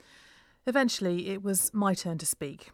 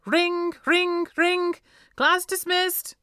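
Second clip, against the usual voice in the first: much higher, louder teacher calling the bell; cried out; 12 semitones higher, 8 dB louder, much slower than usual. A narrator's reading. Mister Baker cries out to dismiss the class, high and loud. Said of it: "cried Mister Baker".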